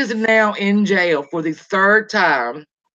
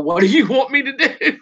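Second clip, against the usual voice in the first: high-pitched imitated protest; mock exasperation; 6 semitones higher than usual. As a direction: throw up the question in higher, exasperated mimicry. Retelling husband's exasperated quarrel.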